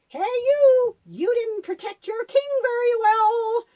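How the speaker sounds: loud, high shout across the hills; David shouts a taunt at Saul's bodyguard from the rocks, loud and high; calling out. A narrator's reading. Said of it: "he called out to Saul's bodyguard".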